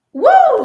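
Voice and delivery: sudden high, loud woo of delight; joyful whoop. Real, not acted. Overjoyed about seeing people work together, loud whoop. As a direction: let a happy cry burst out. Overcome with joy mid-talk.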